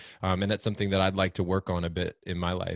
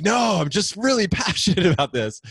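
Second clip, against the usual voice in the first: much higher and louder, laughing as you insist; passionate protest; 10 semitones higher, 6 dB louder than usual. Retold or imitated. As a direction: laugh it off while insisting you're just passionate. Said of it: "I'm like".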